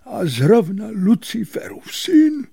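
very high, loud whispery gasp; horrified, airy high exclamation; frightened awe. A narrator's reading. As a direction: say it aghast, voice shooting up. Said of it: "vyděsil se ještě víc".

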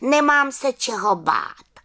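very high-pitched defiant cry; exclaiming little witch. A narrator's reading. Little witch answers the accusing aunt, exclaiming. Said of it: "vykřikla malá čarodějnice".

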